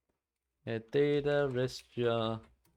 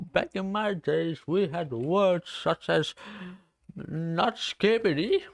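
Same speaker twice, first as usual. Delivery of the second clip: put-on a light, higher-pitched grumpy old-timer; mock old man; 5 semitones higher, 3 dB louder than usual. Eighteen-year-old streamer mocks feeling old, light grumpy old-man voice. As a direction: lightly play a grumbling grandpa. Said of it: "Like, I felt fucking old".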